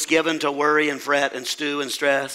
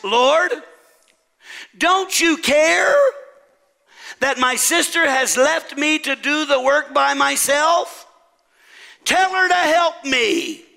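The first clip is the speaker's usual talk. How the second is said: high, loud indignant complaint; scolding; 9 semitones higher, 3 dB louder, slower than usual. Acted. Martha complains to Jesus about her sister, scolding. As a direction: demand it, stretched out and exasperated. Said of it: "Martha scolded her Savior".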